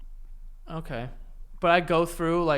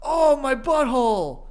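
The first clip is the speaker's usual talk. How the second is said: slow, higher-pitched mock anguish; cartoon guy's wail; 6 semitones higher, 4 dB louder, slower than usual. Retelling a swipe cartoon, mock wailing. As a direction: moan it as a hurt cartoon character. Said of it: "it's a guy just going".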